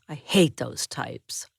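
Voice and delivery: slow, breathy sneering whisper; slow whisper of disdain. Acted. Roommate sneers at patient.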